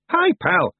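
very high, loud chirpy greeting; cheery, punchy hello; cheerful. A narrator's reading. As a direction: hail an old pal brightly in Scots. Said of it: "said Rob Anybody cheerfully".

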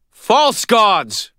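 high, loud proclamation; ringing cry. A narrator's reading. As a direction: cry it out in righteous fury. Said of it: "she cried".

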